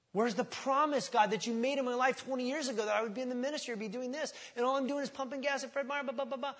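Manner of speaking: high, fast mock whine at God; whiny complaint to God. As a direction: mimic a frustrated believer griping.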